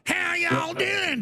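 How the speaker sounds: high, slow, shouted fake Southern greeting; mock Southern drawl. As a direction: ham up a Southern accent like a pandering politician. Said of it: "I wouldn't put on a Southern drawl".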